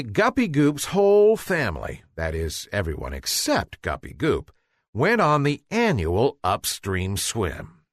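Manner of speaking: usual speaking voice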